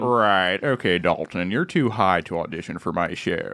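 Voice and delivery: low-pitched TV producer impression; Lorne Michaels. Guest on request plays producer rejecting an auditioner, low imitated voice. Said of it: "Do a Lorne Michaels impression".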